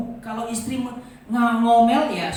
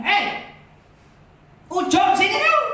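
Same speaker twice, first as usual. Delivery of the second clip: louder, higher Sumatran call, anger showing; angry call; 10 semitones higher, 8 dB louder than usual. Retold or imitated. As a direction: bark the kid's name in open anger. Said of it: "Kelihatan kalau kita marah". (orang Sumatera memanggil anak dengan nada marah)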